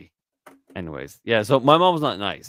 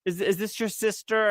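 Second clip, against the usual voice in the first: mock amazed friends; 10 semitones higher than usual. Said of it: "everyone would be like".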